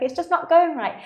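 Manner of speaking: high, whiny imitation of a crying girl; mock upset. Crying girl at the gym explaining herself in a high mock whine. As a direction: play the upset lifter, hammed-up. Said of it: "And they're like".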